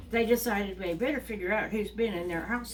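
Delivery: plain reading voice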